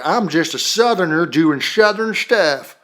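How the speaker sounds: exaggerated, slurred Southern impression; mock Southern accent. Mimicking the commentator's one comedy voice, mock Southern accent. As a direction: put on a silly Southern voice. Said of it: "She has the Southern voice".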